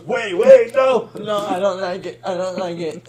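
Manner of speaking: high, loud protest easing off as it goes; mock panicked protest. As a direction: imitate a friend flustered and begging off. Acts friend protesting a teasing advance.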